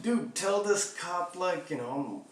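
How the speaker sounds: high, slowed pleading to a friend; begging a friend to vouch before the cop. Retold or imitated. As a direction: beg him to back you up.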